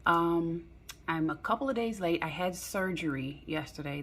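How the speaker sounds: usual speaking voice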